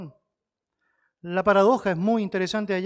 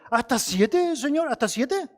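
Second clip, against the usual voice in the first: high pleading Peter; 6 semitones higher, slower than usual. Acted. Pedro's question voiced high.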